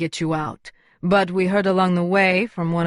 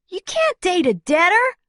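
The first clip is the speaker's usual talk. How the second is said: much higher protesting cry; 12 semitones higher than usual. A narrator's reading. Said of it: "Luna protested".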